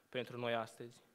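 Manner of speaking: ordinary delivery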